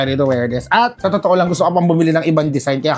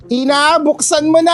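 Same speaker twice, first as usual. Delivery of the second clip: high, loud eager cry to open it; can't wait, tear it open; excited urging; 10 semitones higher, 5 dB louder than usual. Real, not acted. Vlogger eager to open her new shoe box, excited cry.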